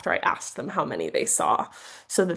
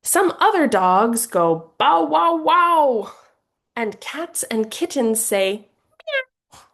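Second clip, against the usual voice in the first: louder, slow dog barks and a kitten meow; sing-song picture-book animal sounds; reading to children; 6 semitones higher, 4 dB louder, slower than usual. Shown as a demonstration. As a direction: voice the dogs and kitten for a toddler. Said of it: "an example of how you might read this book".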